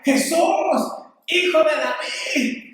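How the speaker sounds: imitated crying out. Blind man calling to Jesus over the crowd, crying out. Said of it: "más gritaba, más decía".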